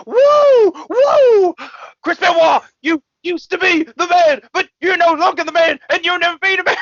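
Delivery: screaming wrestler impression, very high and loud; whoop, then scream the promo in a hammed-up high voice; Ric Flair. Coked-up wrestler berating his rival backstage, mock screaming. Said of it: "It's like screaming at him".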